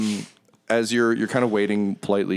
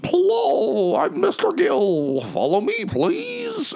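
very high, strained warbling cry; high-pitched fish-person squeal; wailing fish man; much slower than usual. Acted. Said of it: "a fish person flops over and he's like".

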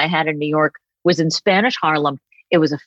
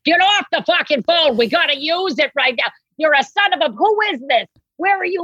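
high, hammed-up yelling with no real volume; mock angry director; 10 semitones higher than usual. Funeral director breaking into her phone call, mock angry high yell. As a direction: bark it like a rude stranger cutting in. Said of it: "funeral home director would pick up the phone and be like".

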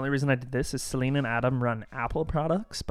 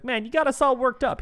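high, loud mock knight voice; hammed-up movie knight, pitched way up; excited knight; 11 semitones higher, 7 dB louder than usual. Voicing knight in movie retelling.

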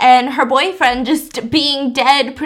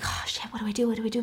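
whispered, frantic what do I do; panicked whisper; 10 dB quieter, much faster than usual. Retold or imitated. Lynch, stranded on the ski lift, whispers in panic. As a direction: hiss it under your breath in panic.

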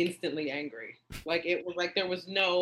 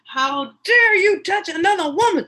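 much louder, much higher furious cry; jealous outraged shout; 14 semitones higher, 11 dB louder than usual. Retold or imitated. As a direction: scream at him in jealous outrage. Retold jealous outburst.

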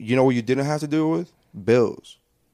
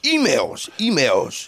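sound cue; 3 semitones higher, much slower than usual. Shown as a demonstration. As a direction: demo a jingle for a podcast segment. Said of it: "a sound cue for emails".

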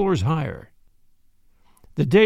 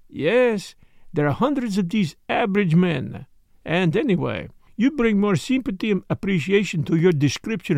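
put-on Poirot, detective with French accent; 3 semitones higher than usual. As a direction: question your friend in a French-accented English.

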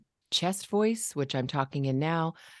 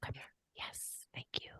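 soft unvoiced whisper to the kids; 14 dB quieter than usual. Shown as a demonstration. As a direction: drop to a hushed whisper to draw them in. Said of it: "bringing it down by whispering to them".

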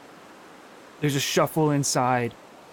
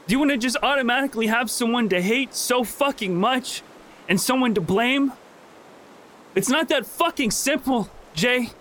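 higher, rising into anguished anger; furious outburst; 8 semitones higher than usual. Acted. Lizzie confronting Jay about his dead sister, anguished and furious. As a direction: hurl it at him through tears.

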